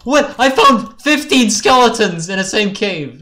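high, loud cry of alarm. Real, not acted. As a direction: call out a sudden horrible find. Alarmed at skeletons in cave.

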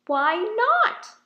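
high, loud wondering "why not?"; old grandpa asking. Acted. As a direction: ponder it aloud, booming. Grandpa echoes Wilbur.

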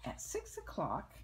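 plain reading voice

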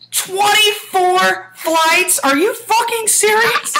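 high, loud, drawn-out shriek of disbelief; re-enacted yell, stretched out and high-pitched; incredulous yelling. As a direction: yell each word slowly in outraged disbelief. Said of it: "you yelling that out loud".

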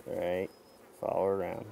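ordinary talk, nothing marked

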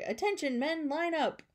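high, drawn-up drill call; barked order. A narrator's reading. Counselor orders the boys into line, barking mildly. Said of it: "barked Rocky".

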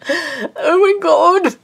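high, loud, slow wail of frustration; near tears. Real, not acted. Crafter failing the stitch, wailing near tears. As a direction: drag it out like you could cry. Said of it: "I just wanna cry".

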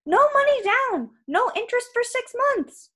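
very high, loud wail; last desperate offer. Acted. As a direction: wail the sales terms in terror. Trapped giant bargains for life.

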